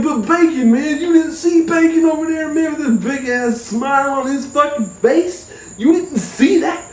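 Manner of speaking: high, frantic pleading; pleading stoned self. Retold or imitated. Stoned narrator frantically pleading with his cousin about the tree. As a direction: beg him to believe you saw the tree.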